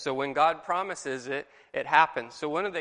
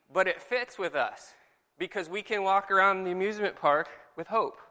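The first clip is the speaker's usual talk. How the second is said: usual speaking voice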